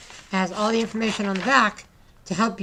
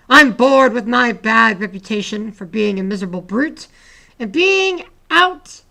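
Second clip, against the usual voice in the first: poem reading; 2 semitones higher, 8 dB louder than usual. Acted. Teacher reading a dragon poem to students, dramatic loud reading. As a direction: perform the poem for the class with a big voice.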